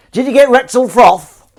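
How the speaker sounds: put-on loud, high, drawn-out northern accent; put-on northern shout. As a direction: belt it out in a broad northern English accent. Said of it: "was that a good northern accent".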